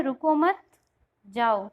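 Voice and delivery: "रुको मत" together, pause, then a low "जाओ"; pause after मत. Shown as a demonstration. Teacher voicing an ambiguous written phrase, pause after "मत". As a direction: break after "मत", drop pitch on "जाओ". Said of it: "उल्टा हम यहाँ लगाए रुको मत".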